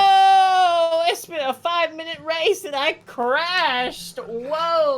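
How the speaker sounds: high, loud hammed-up outcry; mock shout. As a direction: yell it like a crash just happened. Reacting to a game clip.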